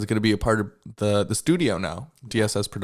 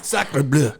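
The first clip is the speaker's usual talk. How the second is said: put-on French accent; 4 semitones higher than usual. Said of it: "do your best Frenchie".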